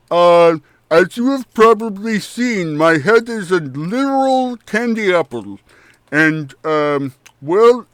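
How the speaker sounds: cheerful goblin talking through a mouthful; mouth-full goblin Chucklehead. Acted. Chucklehead explains the maggot in his head, chewing.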